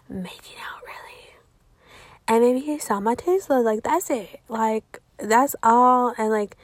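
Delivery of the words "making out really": soft unvoiced whisper; whispered confession; whispered aside. Real, not acted. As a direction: drop to a secret whisper. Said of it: "lowers voice on private detail".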